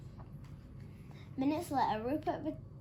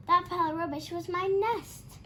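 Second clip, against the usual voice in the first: high, loud, indignant snap; snapped; 7 semitones higher, 7 dB louder than usual. A narrator's reading. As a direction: snap at him, offended. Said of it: "she snapped".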